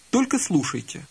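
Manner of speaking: ordinary delivery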